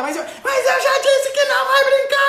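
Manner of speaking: hammed-up fed-up mom; exasperated mother. Mother yelling at child to stop playing, hammed-up. Mother losing patience, mock scene.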